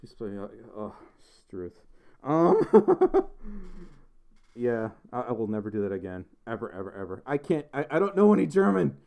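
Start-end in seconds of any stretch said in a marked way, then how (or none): 2.7-3.9 high burst of laughter. Real, not acted. Streamer laughing at own bad accent, high laugh. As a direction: crack up briefly.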